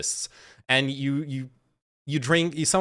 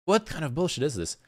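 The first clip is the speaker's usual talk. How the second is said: normal talking voice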